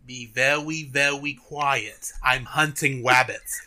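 slow, "very" and "rabbits" with W for R; cartoon hunter lisp. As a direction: say "very" as "vewy" and "rabbits" as "wabbits", drawn out. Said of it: "literally turns around to the dog and says".

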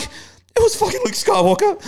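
high, slow, blubbering wail; mock sobbing. Telling girlfriend why he's crying, high slow mock sob. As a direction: whimper it out like a blubbering kid. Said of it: "I'm like".

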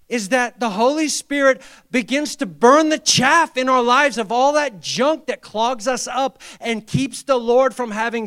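loud, high, fervent preaching; full-force preaching. Acted. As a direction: preach it hard to the congregation.

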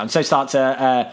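normal talking voice

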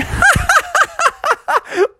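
very high squealing giggles; hysterical laughing. Acted. Young bears laughing at Bubby's crash, put-on hysterics. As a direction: cackle like kids rolling about. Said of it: "were rolling around on the ground just going".

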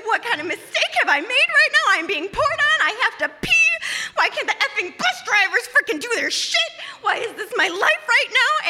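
high, half-laughing mock sob; laugh-crying. Bladder full in the rain, she breaks down laughing and crying. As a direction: wail at her own bad luck, half laughing. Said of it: "I just start crying".

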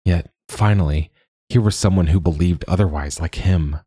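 ordinary delivery

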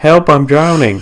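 panicked cry for help; mock drowning plea.

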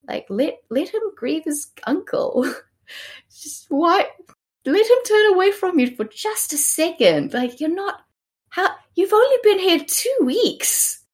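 high-pitched mock outrage; exasperated protest. Co-host scolds Margaret for not letting Henry grieve, exasperated. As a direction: plead in squeaky disbelief.

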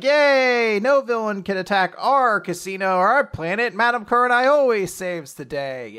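high, loud sing-song cheer; celebrating patrons. Acted. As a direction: cheer with exaggerated glee. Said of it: "patrons of the casino start celebrating".

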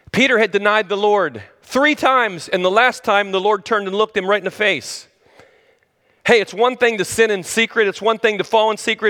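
usual speaking voice

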